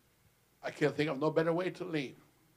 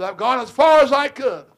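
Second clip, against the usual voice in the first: louder, higher proclamation; proclaiming last words; 8 semitones higher, 15 dB louder than usual. Retold or imitated. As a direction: declare it as final words. Father's last words retold.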